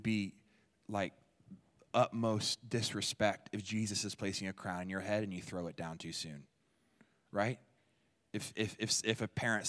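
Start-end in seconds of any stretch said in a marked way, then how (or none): none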